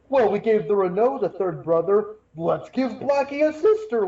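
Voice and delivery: lightly voiced writers pitching an idea; a hint of a breezy showrunner; mock writers' voice. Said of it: "the one thing I don't like is".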